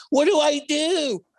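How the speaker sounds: high, slow, helpless squeak; mock panic. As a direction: play the clueless victim in mock panic. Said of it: "he's like".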